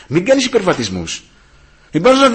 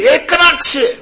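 high, slow, drawn-out call; crying out; 5 dB louder, much slower than usual. Acted. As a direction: raise the pitch and stretch it into a cry. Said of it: "φωνάει, φωνή δυνατή". (κραυγαλέα, τραβηγμένη φωνή)

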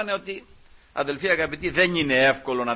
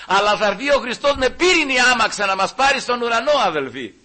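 loud, high, impassioned sermon cry; fervent preaching; 8 semitones higher, 7 dB louder than usual. Real, not acted. Preacher crying out to the congregation, loud and fervent. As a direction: proclaim it with full conviction.

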